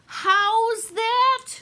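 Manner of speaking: very high, loud shout; shouted question. Acted. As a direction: yell back as if you can't hear. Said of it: "shouted Meg".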